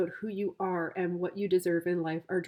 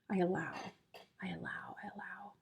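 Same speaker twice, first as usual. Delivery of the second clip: fading whisper, softer each time; hushed mantra trailing into silence; whispered affirmation; 10 dB quieter, faster than usual. Real, not acted. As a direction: breathe the words out to yourself, dying away.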